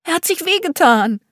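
put-on very high worried little boy; upset child. A narrator's reading. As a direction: plead it anxiously as a child. Frightened boy insisting to mother.

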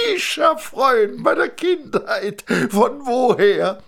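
put-on thin man amazed to see his old friend; a high, gushing thin man; delighted thin man.

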